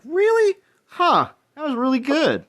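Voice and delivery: high, amazed disbelief; surprised. Retold or imitated. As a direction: sound suddenly impressed and surprised. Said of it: "and you're like".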